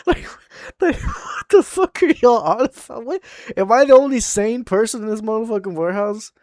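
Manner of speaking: loud, high-pitched incredulous outburst; exasperated disbelief. Retold or imitated. Warehouse worker about his coworkers, exasperated disbelief. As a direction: protest as the last sane one, amused.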